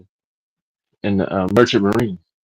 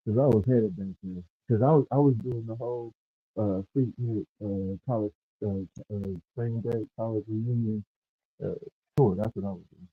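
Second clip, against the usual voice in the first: muffled; 12 dB quieter than usual. Real, not acted. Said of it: "you sound muffled".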